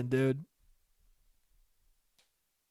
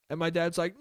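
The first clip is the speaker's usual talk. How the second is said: normal talking voice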